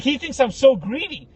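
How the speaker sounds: ordinary delivery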